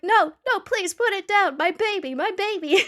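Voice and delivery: high, loud hammed-up pleading; mock panic. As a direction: beg frantically, as if playing a distraught parent. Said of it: "I'm like".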